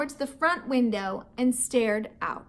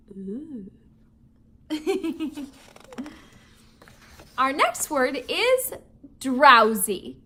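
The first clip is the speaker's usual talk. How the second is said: ordinary delivery, nothing marked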